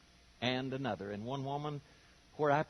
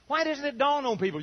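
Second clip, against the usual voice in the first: ordinary talk, nothing marked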